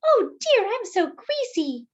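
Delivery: high, prissy lady voice, loud on oh dear; a fussy dainty lady dismayed at being greasy; fussy powdered donut. Acted.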